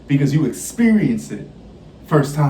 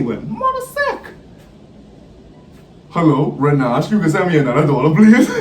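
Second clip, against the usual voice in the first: pleading louder and louder, voice jumping up on please; mock pleading beg; 5 semitones higher, 5 dB louder than usual. To friend Renaj on the phone, asking for another dollar, pleading louder. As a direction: beg your friend for one more dollar, half laughing.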